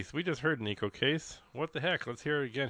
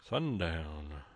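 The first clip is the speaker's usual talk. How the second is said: low, drawn-out cowboy voice; big deep voice; 5 semitones lower, 4 dB quieter than usual. Shown as a demonstration. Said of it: "You gotta say that with a big, deep voice".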